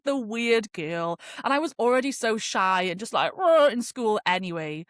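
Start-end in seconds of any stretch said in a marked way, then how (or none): 3.4-3.7 loud, high mock roar; rowdy lads. Imitating lads at school, rowdy roar. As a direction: burst out like boisterous schoolboys. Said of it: "you know what lads are like".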